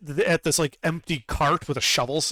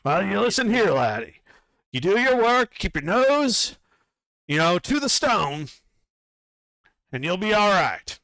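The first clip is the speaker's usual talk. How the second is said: higher bossy overseer voice; Duergar foreman calling out; 6 semitones higher than usual. Acted. Duergar foreman calls out to Hebron, higher and bossy. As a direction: hail the new worker like a pushy dwarf boss. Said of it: "And he calls out".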